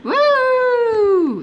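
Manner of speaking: high, loud squeal of wonder; amazed wow. Real, not acted. Player amazed at the game world, squealing wow. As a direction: let the wow shoot way up.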